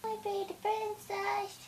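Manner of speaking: very soft, high coo; hushed high endearment. Real, not acted. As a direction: coo tenderly in a tiny high voice.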